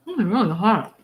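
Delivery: talking while eating hot food; mouth full. Real, not acted. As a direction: speak around a hot bite. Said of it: "I'm gonna be eating".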